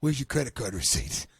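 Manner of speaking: very low, dull confession; deep lumbering suspect caught out; dopey executive. Pretend. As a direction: sheepishly own up to the free dinner. Deep voice continues interrogation.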